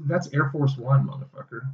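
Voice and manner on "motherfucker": breathy whispered insult tacked on; whispered jab. Real, not acted. Teasing his co-host after correcting him, whispered.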